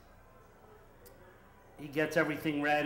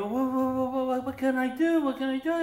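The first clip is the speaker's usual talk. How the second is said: high, pleading broken English; flustered barber; 10 semitones higher, 4 dB louder than usual. Retold or imitated. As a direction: plead helplessly. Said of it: "And the barber".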